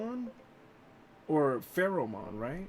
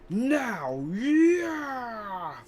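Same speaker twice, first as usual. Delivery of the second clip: excited shout; 9 semitones higher, 6 dB louder than usual. Acted. Character battle cry.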